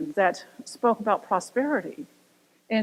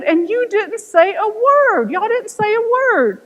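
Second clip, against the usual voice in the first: high, louder amazed exclaim; amazed pilot; 9 semitones higher, 6 dB louder than usual. Retold or imitated. Pilot to passengers, loudly amazed. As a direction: lift it up in disbelief. Pilot voiced, building excitement.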